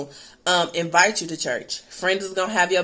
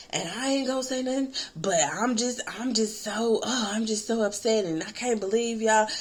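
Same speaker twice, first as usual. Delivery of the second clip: mom upset, near tears; 3 semitones higher, 5 dB quieter than usual. Retold or imitated. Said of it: "she might have even been crying".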